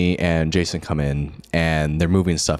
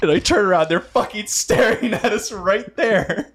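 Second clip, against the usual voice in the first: excited retelling; 3 dB louder than usual. Retold or imitated. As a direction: blurt it out high and stretched, aghast and amused. Retelling being caught by staring brothers.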